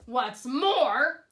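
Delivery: a loud sharp snap; snapped. A narrator's reading. Lorax snaps at the Once-ler, loud and sharp. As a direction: snap the words out. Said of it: "snapped the Lorax".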